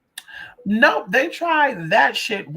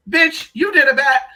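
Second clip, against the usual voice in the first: retold accusing shout; 8 semitones higher, 9 dB louder than usual. Acted quarrel in retold scene.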